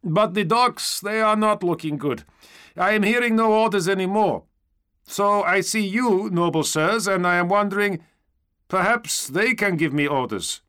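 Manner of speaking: high, loud, earnest trooper voice; stormtrooper seeking orders. A narrator's reading. Stormtrooper asking noble sirs for orders, high earnest voice. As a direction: report up to the knights, pitched high.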